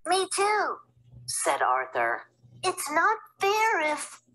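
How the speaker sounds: put-on high eager little boy; young boy voice. Little brother Arthur wanting to go too, high eager boy. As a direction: pipe up like a small kid.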